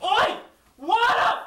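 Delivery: sharp barked shouts; loud sharp voice. Acted. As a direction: snap out each word loudly. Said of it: "Why are you shouting?".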